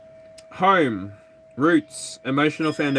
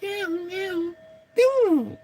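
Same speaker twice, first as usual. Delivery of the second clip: very high mewing meow; cat meows; slower than usual. Acted. Meowing at her cat, very high. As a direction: mew like a kitten.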